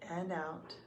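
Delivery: soft, unhurried meditation voice with long pauses; calm, even guidance, leaving room to breathe; guided meditation. Real, not acted.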